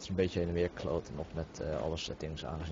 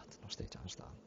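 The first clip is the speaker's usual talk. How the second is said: whisper; 10 dB quieter than usual. Real, not acted. Muttering to self about echo.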